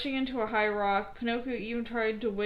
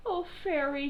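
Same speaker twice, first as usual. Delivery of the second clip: high grieving puppet voice; 6 semitones higher than usual. A narrator's reading. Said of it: "he said to the air".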